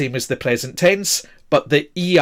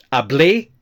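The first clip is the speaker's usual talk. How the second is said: hablé rising onto its last syllable; final-syllable stress. Shown as a demonstration. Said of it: "with the stress on the A".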